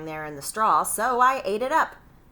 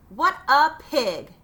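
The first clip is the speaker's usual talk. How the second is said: louder, slow, higher scornful verdict; disgusted scorn; 6 semitones higher, 8 dB louder, slower than usual. A narrator's reading. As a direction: spit it out in disgust at the pig. Wolf disgusted at rude pig.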